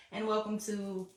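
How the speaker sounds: giggly welcome, pitch up; laughing. Real, not acted. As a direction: start the intro while a laugh bubbles through. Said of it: "I don't know why I'm laughing".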